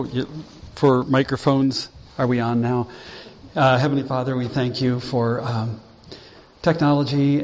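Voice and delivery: low, hushed prayer voice. Acted. Opening prayer, low and soft.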